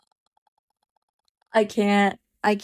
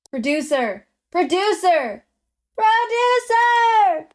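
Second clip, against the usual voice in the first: calling the producer, growing to a long high shout; 7 semitones higher than usual. Acted.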